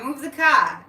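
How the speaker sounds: loud Boston "Move the cah", R dropped; punchy Boston accent, no R. Shown as a demonstration. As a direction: say "car" as "cah", Boston style. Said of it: "there's no R".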